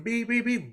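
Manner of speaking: high quick beep beep beep; imitated car horn. Telling the story of the crash, high mock horn beeps. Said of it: "My wife's beeping".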